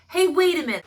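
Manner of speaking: hammed-up cop shouting after him; mock shout. Annoyed cop grabbing Stabler, mock loud shout. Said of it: "He grabs Stabler's shoulder".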